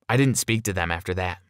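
usual speaking voice

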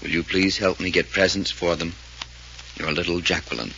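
normal talking voice

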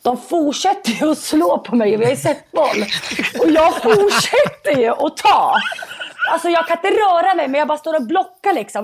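rising, laughing excitement; racing through the rally with a laugh; breathless thrill of the story; excited. Real, not acted. Excited retelling of match rally.

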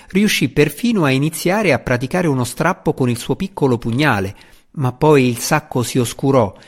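plain reading voice

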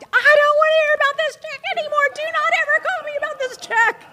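high, loud furious tirade, re-enacted; shrieking retold outburst, very high-pitched; going off on someone. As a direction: replay yelling down the phone at a bank clerk. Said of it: "went off on her".

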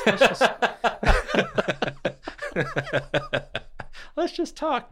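high, laughing through "let's just talk"; words squeezed out between laughs. Real, not acted. Laughing off a friend's worry, words breaking into laughter. Cracking up mid-sentence.

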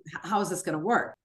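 normal talking voice

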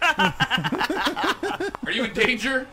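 slow, high, stretched question; mock dramatic question. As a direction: ask it like a hostage rescuer. Talking to beeping phone.

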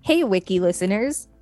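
usual speaking voice

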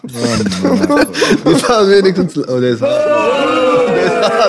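words squeezed out through laughter; laughing. Real, not acted. Cracking up at a friend's dark joke, laughing. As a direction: laugh while you repeat the joke.

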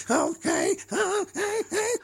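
high robotic okays. Pretend. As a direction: keep the Dalek-like robot going. Robot host voice continues.

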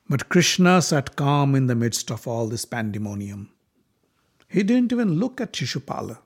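usual speaking voice

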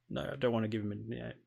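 quick, soft, weary brush-off; dismissive refusal. Acted. Player's reply to the game.

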